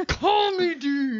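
slow, high, loud, drawn out; hammed-up movie hero line. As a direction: ham up the big intro line. Hams up character's intro line.

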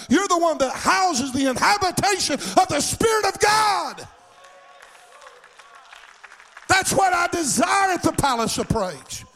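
high, shouted preaching climax; preaching at full force. Acted. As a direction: lift it up and shout it to the church.

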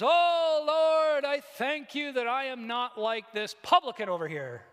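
loud, high mock prayer intoned on a held note; self-righteous show-off praying aloud; sanctimonious Pharisee. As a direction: pray pompously, looking down on the man nearby. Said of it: "It's this passive-aggressive, judgmental way of talking".

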